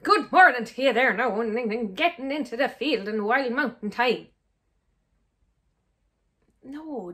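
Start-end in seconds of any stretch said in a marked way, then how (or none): 0.0-4.3 hammed-up stage Irish accent, louder; a cheesy movie Irish accent, laid on thick; overdone Irish accent. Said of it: "We're not all going".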